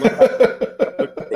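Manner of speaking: high laughing mock complaint at the kids; mock laughing protest. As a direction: squeal it out half laughing. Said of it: "And I'm like".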